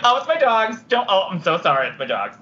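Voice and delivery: Sasha impression. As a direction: lightly voice a flustered Sasha. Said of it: "I can just do a Sasha impression".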